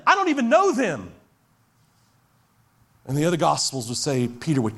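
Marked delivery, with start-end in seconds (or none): 0.1-1.1 high, louder flat rejection; heated denial. Acted. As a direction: shut it down hard.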